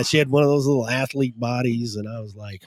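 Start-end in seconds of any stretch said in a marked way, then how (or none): none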